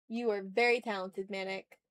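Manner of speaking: ordinary delivery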